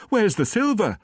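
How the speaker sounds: high, loud, alarmed housekeeper; alarmed cry. A narrator's reading. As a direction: cry it out in dismay. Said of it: "she cried".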